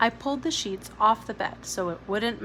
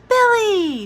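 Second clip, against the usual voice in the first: very loud, high shout across the station; 12 semitones higher, 12 dB louder than usual. A narrator's reading. Jamie to Billy at the station, a loud shout. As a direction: call out to a friend far off. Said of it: "Jamie shouted".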